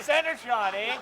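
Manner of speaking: mock his brother's praise right back at him; mocking echo. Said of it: "Two can play that game".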